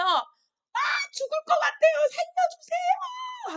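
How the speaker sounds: high, slow, strained cry for help; anguished plea. Retold or imitated. Enslaved Israelites crying out to be saved, high anguished plea. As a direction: beg for your life as a suffering slave. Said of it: "얼마나 힘들어했는지 몰라요".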